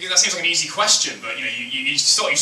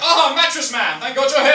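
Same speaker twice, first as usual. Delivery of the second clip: high, loud grateful policeman; 9 semitones higher, 4 dB louder than usual. Retold or imitated. Policeman greeting his hero, high and eager. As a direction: hail the hero, pitched way up. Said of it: "policemen being like".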